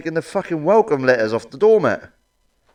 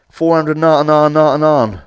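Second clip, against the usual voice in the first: advert voice; 3 semitones higher, 9 dB louder, slower than usual. Retold or imitated. Mocking advert price.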